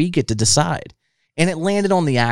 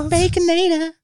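squeaky hammed-up ad voice, much higher; mock commercial. Host joining the fast-food ad joke in a silly voice. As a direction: shout the product name like an ad.

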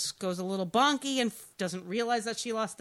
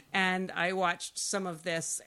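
usual speaking voice

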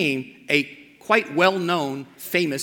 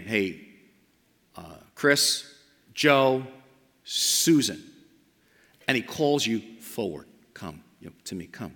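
a hint of Jesus calling softly, much lower and quieter; gently calling voice of Jesus; 8 semitones lower, 5 dB quieter than usual. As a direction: call each name tenderly, barely above a breath. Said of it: "Calls your name".

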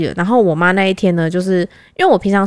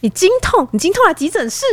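incredulous doctor; 10 semitones higher than usual. Retold or imitated. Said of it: "他说".

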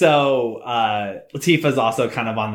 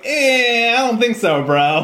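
higher, louder mock skepticism; Cloud doubtful; 9 semitones higher, 4 dB louder, slower than usual. Cloud doubts Sephiroth is cool, comic skepticism. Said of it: "And Cloud's like".

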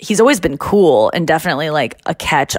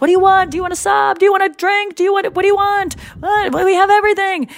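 high, rapid-fire excited offers; eager host; 14 semitones higher than usual. Retold or imitated. Jerry offering guests food and drink, high eager hosting. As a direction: imitate an overexcited host, pitched way up. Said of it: "He goes".

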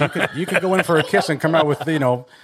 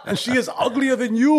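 put-on much higher, blunt Austrian accent; Schwarzenegger impression; 12 semitones higher than usual. Arnold insulting his wife, Austrian accent. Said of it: "Arnold can't do it either".

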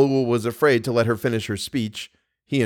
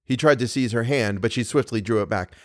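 ordinary delivery

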